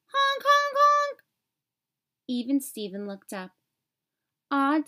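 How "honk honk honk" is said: high, loud honking; horn honks. A narrator's reading. Odd Todd honking his tricycle horn at Even Steven. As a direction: honk like a parrot-shaped horn.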